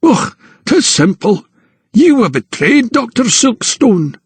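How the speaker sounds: high, drawn-out Scottish-flavoured taunt; mocking anatomist. A narrator's reading. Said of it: "Hunter let out a mocking laugh".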